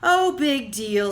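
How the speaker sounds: slow, unimpressed shrug; loud but bored brush-off. Acted. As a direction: drag it out like a yawn. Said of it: "who gave a bored yawn".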